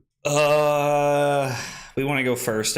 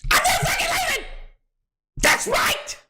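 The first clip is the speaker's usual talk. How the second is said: hyped defiance. Acted. Streamer quoting DiCaprio to chat, hyped and high. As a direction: shout it like a triumphant hero.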